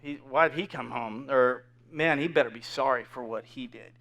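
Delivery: low, hushed onlooker gossip; hushed, disapproving aside; whispering bystanders. Acted. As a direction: mutter it softly behind someone's back. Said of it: "bystanders are, are whispering".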